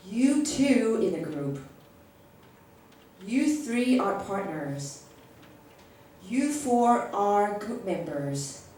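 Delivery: slow, deliberate group assignments; slow instructions. Shown as a demonstration. Teacher assigning student groups, slow and clear. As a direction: spell out each group slowly. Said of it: "grouping students".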